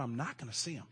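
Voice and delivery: ordinary talk, nothing marked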